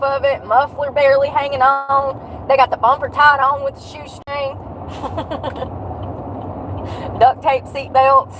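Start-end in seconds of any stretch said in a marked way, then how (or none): 4.6-7.1 airy silent laugh; wheezy giggle; breathy laugh. Real, not acted. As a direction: laugh under your breath. Laughing at maniac drivers.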